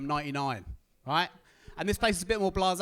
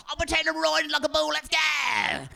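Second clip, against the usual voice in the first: much higher, louder, rising to a shout; mock bravado; 11 semitones higher, 6 dB louder than usual. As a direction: psych yourself up like a rodeo rider. Bravado before jumping.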